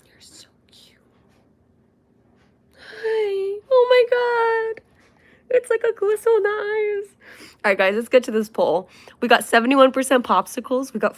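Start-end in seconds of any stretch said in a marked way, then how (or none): 0.1-1.0 soft unvoiced whisper to the dog. Real, not acted. Owner whispering to her puppy. 2.8-7.1 much higher, cry-like squeal. Real, not acted. Owner gushing over puppy eyes, squealing high.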